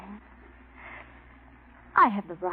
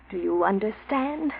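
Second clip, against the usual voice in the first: ordinary delivery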